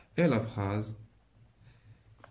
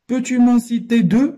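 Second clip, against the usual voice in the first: much higher, loud reading of the question; 11 semitones higher, 13 dB louder than usual. Shown as a demonstration. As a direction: call the question out to the class. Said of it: "teacher reads dialogue question". (question lue très fort et aiguë)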